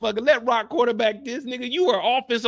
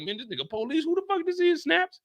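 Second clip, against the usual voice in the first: high mock confusion; 8 semitones higher, 3 dB quieter than usual. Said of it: "I'm like".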